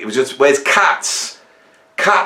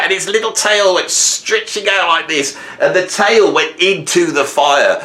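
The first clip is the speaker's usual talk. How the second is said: ordinary talk, nothing marked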